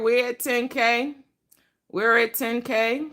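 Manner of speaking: slow, high celebratory singsong; singsong announcement. Real, not acted. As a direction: drag it out in a happy chant. Celebrates reaching ten thousand.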